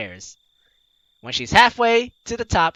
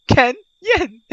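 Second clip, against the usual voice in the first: excited exclamation; 8 semitones higher, 5 dB louder than usual. Acted. Echoing Kaito's joke.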